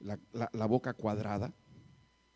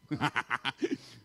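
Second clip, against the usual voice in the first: short chuckling burst; real laugh at his own joke. Real, not acted. (risa breve)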